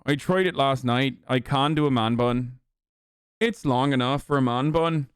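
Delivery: put-on Irish accent. Streamer showing off his hair in Irish accent. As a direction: chat away in mock Irish. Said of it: "do an Irish accent".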